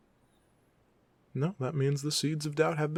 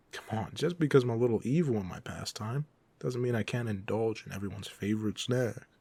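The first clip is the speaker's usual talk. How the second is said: ordinary talk, nothing marked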